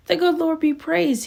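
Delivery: high, loud joyful cry; shouted praise. Acted. Jeter seeing Sister Bessie on the porch, loud joyful cry. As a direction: call out in relief to the preacher. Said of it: "he shouted".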